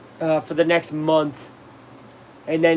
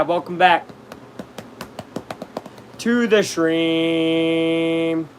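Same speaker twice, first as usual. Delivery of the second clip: very slow, sustained words; greatly elongated delivery; drawn-out welcome; much slower than usual. Pretend. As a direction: stretch the last word into a long held note.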